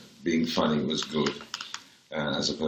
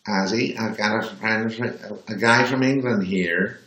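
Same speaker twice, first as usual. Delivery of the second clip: put-on talking down to a drunk; 3 semitones higher than usual. Party guest introducing an Englishman to drunk [name], condescending. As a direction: spell it out as if to a nitwit. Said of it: "the way people talk to drunks".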